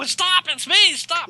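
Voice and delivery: high-pitched mock scream of mom; imitated panicked yell. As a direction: squeal it like a mother under attack. Retells mom begging while being hit.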